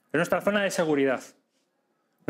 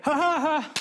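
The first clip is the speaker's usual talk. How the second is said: demo laugh; 11 semitones higher, slower than usual. Shown as a demonstration. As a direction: throw out a big hearty laugh. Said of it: "yo me puedo reír".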